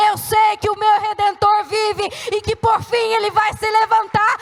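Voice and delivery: building from firm insistence to loud, high preaching shout; impassioned declaration. Acted. Woman of faith declaring her Redeemer lives, building to a shout.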